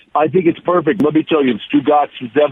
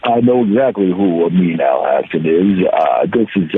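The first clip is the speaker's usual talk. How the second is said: Stugatz impression; 7 semitones lower than usual. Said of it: "me answering your question as Stugatz".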